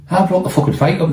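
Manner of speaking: to the cops grabbing him, outraged protest; indignant protest. Retold or imitated. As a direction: insist you're innocent, indignant. Arguing with the arresting cops.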